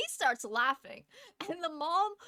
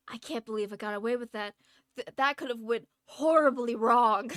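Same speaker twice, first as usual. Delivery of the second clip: relieved disbelief; 8 dB louder than usual. Retold or imitated. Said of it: "I'm just sitting there like".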